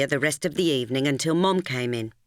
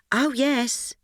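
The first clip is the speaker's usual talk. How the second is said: airily; 10 semitones higher than usual. Acted. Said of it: "I said airily".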